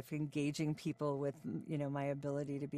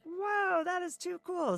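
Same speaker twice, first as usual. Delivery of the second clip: impressed exclamation; 3 dB louder, slower than usual. Retold or imitated. Producers' excited reaction quoted.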